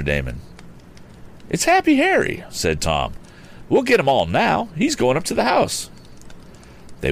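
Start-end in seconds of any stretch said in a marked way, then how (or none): 1.5-2.4 put-on high-pitched boy inventor voice; much higher pitch, keyed-up young hero; young Tom. A narrator's reading. Tom spots Happy Harry from hiding, high excited young voice. Tom's line in the story. 3.7-5.9 put-on high, eager young hero; young Tom. A narrator's reading. Tom watches the tramp head for the house, high eager voice. Tom's line in the story.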